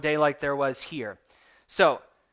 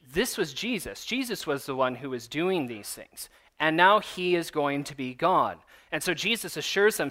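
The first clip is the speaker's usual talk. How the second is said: ordinary talk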